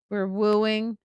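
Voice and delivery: ordinary talk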